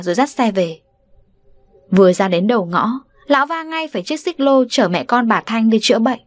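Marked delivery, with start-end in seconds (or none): none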